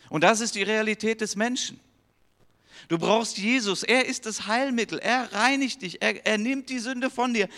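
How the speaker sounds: high, loud fervent preaching; preaching at full force. Acted. Preacher urging the congregation to find Jesus, fervent preaching. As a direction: proclaim it to the whole congregation.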